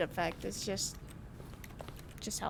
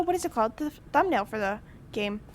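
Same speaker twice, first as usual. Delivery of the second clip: usual speaking voice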